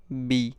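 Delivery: बी said hard and loud, a heavy voiced B; heavy B sound. Shown as a demonstration. Teacher showing students the heavy B sound, loud and forceful. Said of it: "heavy आवाज़".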